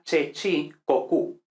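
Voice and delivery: Italian-style che, chi, co, cu; Italian pronunciation. Shown as a demonstration. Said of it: "pues en italiano sería".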